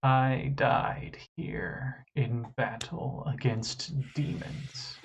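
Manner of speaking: slow whispered ghost voice; ghostly whisper. Acted. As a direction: breathe it like a dead spirit. DM voices dead creature.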